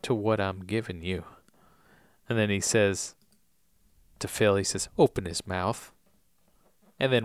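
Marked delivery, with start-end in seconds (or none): 0.0-1.2 soft Southern voice. Acted. Recapper as Oldham calming his prisoner, soft Southern voice. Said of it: "He says in a soft southern voice". 5.0-5.9 quiet, low Southern order; soft Southern voice. Acted. Recapper as Oldham instructing Phil, soft Southern voice. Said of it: "in a soft southern voice".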